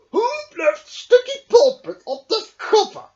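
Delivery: loud gruff bear voice; grumbling bear. Acted. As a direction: complain as the cross bear. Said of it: "he grumbled".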